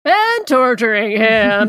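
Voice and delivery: slow, loud, booming narrator; dramatic announcer voice. Shown as a demonstration. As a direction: intone it like a news anchor. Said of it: "I just like that voice".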